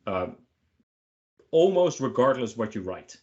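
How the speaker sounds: normal talking voice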